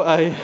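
high, breathy burst of laughter; real laughter. Real, not acted. Preacher to congregation, overcome by his own story, laughing. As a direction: let the laugh take over completely.

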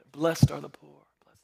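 whispered, low and reverent; soft whisper. Retold or imitated. Preacher imitating Jesus teaching, whispered low. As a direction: breathe it like a hushed sermon.

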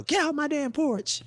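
high-pitched mock yell, no real volume; imitated yell. Grandmother at the window chasing off porch sitters, mock yelling. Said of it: "She'd be yelling out the window".

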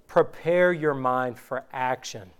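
ordinary talk, nothing marked

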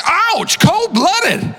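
high, laughing "ouch, that stings"; stung mock outrage. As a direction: react like you've been burned, half laughing. Reacting to a friend's text.

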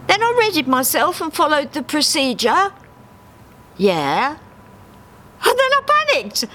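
very high duck recounting, quick at the end; Dave's voice. Acted. Dave recounting last night to Harry, high. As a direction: tell it earnestly, then blurt the panic.

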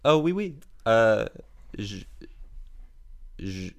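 hesitant mock French accent, groping for words; fumbling French with a put-on accent; foreign accent in French. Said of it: "I'm trying to speak French".

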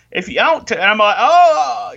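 self-correcting teacher; catching yourself. Retold or imitated. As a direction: realise the slip mid-sentence. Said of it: "It's like".

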